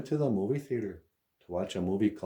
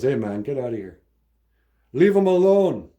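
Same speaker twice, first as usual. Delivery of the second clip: dad yelling at the robber, higher and louder; protective shout; 5 semitones higher, 9 dB louder than usual. Retold or imitated. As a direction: shout him off, leave him alone. Dad confronts robber in story.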